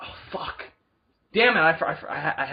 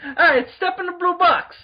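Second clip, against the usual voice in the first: put-on Brooklyn accent; 11 semitones higher, 5 dB louder than usual. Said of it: "we have a Brooklyn Doctor now".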